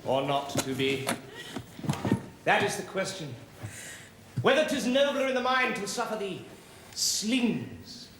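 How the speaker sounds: grand stage recitation; theatrical soliloquy; Shakespearean declaiming. Acted. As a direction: declaim it like Hamlet on opening night. Actor declaiming Hamlet.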